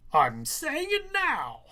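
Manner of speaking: slow, high, loud teacher's snap; a cold teacher cutting the student off; stern teacher. Acted. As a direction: lay down the law, slowly and loudly.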